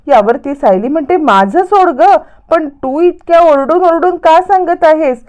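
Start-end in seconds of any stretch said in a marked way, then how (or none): 1.2-5.2 higher-pitched, impatient comeback; exasperated retort. Acted. Sayali brushes off Priya and questions her shouting, exasperated retort.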